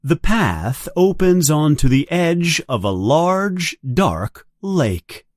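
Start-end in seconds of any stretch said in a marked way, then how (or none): none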